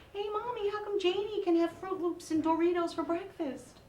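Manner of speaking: put-on high, sing-song child voice; imitating a little kid. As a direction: pipe up like a small kid asking mom. Child's question voiced in story.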